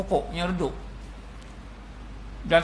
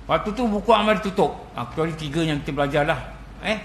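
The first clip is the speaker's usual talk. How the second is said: ordinary delivery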